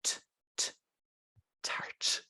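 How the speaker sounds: T, T, TART in soft, airy whispered Ts; soft Irish T. Shown as a demonstration. Host demonstrates Irish sound to co-hosts, soft quiet T. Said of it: "We have a soft T".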